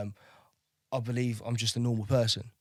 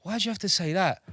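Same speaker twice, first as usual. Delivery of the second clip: 1 indignant retort; 8 semitones higher than usual. Retold or imitated. Retort to a racist remark.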